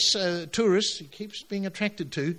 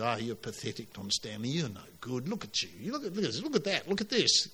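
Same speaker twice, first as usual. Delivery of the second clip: low, hushed, taunting devil voice; whispering devil; 4 semitones lower, 5 dB quieter than usual. Acted. Preacher imitating the devil belittling a man, hushed and taunting. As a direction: imitate the devil needling someone under the breath. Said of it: "have you ever heard that whispering voice? ... imitating the devil".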